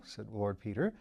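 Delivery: plain reading voice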